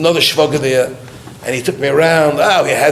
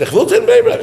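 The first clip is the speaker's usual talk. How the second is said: put-on much higher, childish demand; mock whining; 3 dB louder than usual. Begging the Rebbe to go to Bnei Brak, high mock whine.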